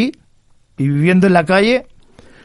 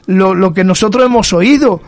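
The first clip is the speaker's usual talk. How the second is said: higher-pitched, emphatic preacher's voice; fervent preaching; 5 semitones higher than usual. Real, not acted. Preacher telling the church how God restores lives, fervent. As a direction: preach it with rising fervour, leaning on each declaration.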